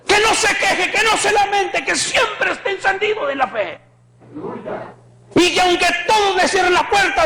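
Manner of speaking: alza la voz y predica con fuerza; predicación encendida. Acted. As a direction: clama al pueblo con fervor. (predicador exaltado)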